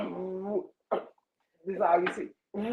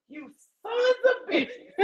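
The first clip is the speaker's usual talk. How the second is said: retold outrage; 11 semitones higher, much slower than usual. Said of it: "I'm hot as fish grease".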